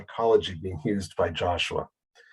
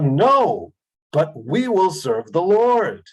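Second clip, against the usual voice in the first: emphatic declaration; 7 semitones higher, 6 dB louder, slower than usual. Acted. People declaring to Joshua.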